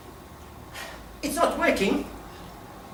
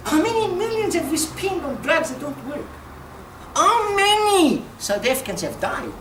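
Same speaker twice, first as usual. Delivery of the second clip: ordinary delivery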